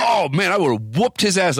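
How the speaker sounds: high-pitched worked-up boast; imitated bravado. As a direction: mimic a friend's belated tough talk. Imitates friend's belated bravado.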